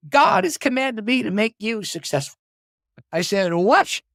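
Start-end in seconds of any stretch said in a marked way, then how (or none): 0.1-2.4 higher-pitched imitation of a Southern caller; Southern accent. Famous author announces a mission by phone, in a Southern accent. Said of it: "this man with a Southern accent". 3.5-4.0 high, loud, startled question; astonished. Retold or imitated. Driver reacting to an author's startling phone call, astonished. Retold astonishment at caller's announcement.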